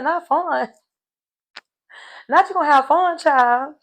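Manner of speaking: high, loud disbelieving repeat; mock outrage at his words, laughing; incredulous squeal. Real, not acted. Host reacting to his confessional, high incredulous squeal. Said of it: "I said".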